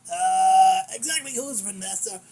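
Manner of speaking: ordinary talk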